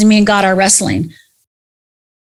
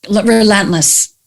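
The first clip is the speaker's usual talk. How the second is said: usual speaking voice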